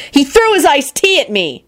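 high indignant complaint; furious. A narrator's reading. Woman answers the mocking diner, furious. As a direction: complain hotly, pitched well up. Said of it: "Shala replied furiously".

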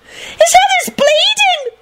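very high, drawn-out squeal; delighted squeal. A narrator's reading. Tall blonde over injured Lupin, squealing delightedly. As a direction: squeal with morbid glee. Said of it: "squealed delightedly".

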